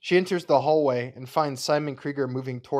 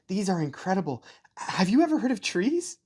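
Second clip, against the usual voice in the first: put-on high, bright child voice, words tumbling out; a little boy proudly showing off; eager young boy; 7 semitones higher than usual. Ainz shows his mother his new books, high eager child voice.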